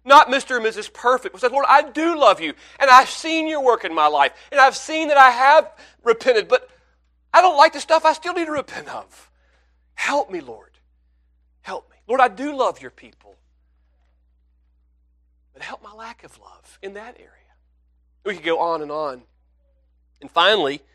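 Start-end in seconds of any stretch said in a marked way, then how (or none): 1.5-13.4 higher and louder, heartfelt cry; earnest pleading prayer. Pretend. Child of God crying out over remaining sin, pleading. 15.5-17.3 soft voiceless whisper; whispered prayer. Pretend. Believer ending the prayer in a hushed whisper.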